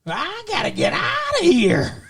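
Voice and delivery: high, twangy cartoon prospector; old prospector. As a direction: do a Yosemite Sam yelp. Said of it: "He sounded like, uh, what's the".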